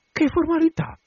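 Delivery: high, startled "Che formalità?"; astonished question. A narrator's reading. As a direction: ask it in sudden bewilderment, pitch leaping up. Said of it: "chiese donna Elena stupita".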